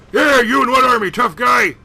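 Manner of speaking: high, loud sneer; mock the player; villain jeering through the door; taunting villain. Norton taunts.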